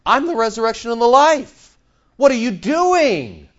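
high, loud exasperated outburst; indignant. Acted. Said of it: "He's indignant about what's happening here".

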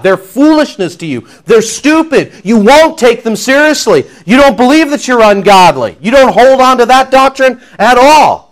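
loud, high pulpit preaching; fiery sermon delivery; preaching at full force. Acted. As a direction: drive the doctrine home hard.

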